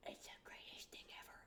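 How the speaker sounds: very soft, voiceless whisper; awed whisper. Real, not acted. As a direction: whisper it like an amazed confession. Awed aside after watching it.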